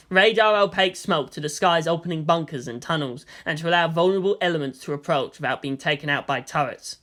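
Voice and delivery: ordinary delivery, nothing marked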